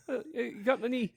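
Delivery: laughing mock Kiwi accent, high; a giggly put-on New Zealand accent. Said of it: "It'll be like New Zealanders".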